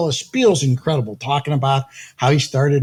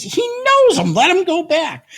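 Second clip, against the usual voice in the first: much higher, eager insistence; high excited vouching; 12 semitones higher than usual. Retold or imitated. The manager vouches for him to the doorman, high and eager. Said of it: "he looks at me and he says".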